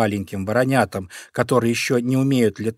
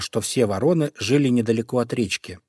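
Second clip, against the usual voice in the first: ordinary delivery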